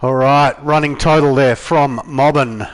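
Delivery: big loud announcer call. Real, not acted. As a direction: call it out to the crowd. Commentator calling the race.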